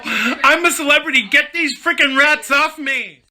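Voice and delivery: very high-pitched squeal of protest; mock celebrity panic.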